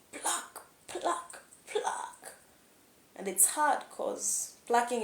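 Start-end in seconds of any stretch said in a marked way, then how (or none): 0.1-2.3 breathless effortful whisper, pitched high; strained whisper. Acted. Narrating the sunflower's struggle, in a strained high whisper. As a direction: strain as if pulling yourself free.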